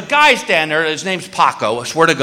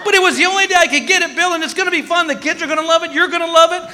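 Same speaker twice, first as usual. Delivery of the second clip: much higher and fast, gushing wife; eager wife; 11 semitones higher, faster than usual. Retold or imitated. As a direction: sell it like a giddy wife. Wife pitching the trip.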